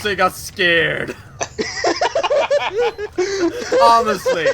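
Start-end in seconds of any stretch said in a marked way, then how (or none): 1.4-4.5 laughing. Real, not acted. Laughing at the chat.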